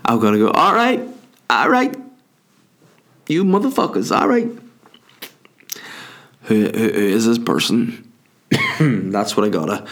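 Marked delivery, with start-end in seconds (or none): 0.5-4.5 much higher strained quote voice; squeaky mock outburst; high-pitched outburst. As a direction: act out a high-pitched rant. Acting out his own outburst.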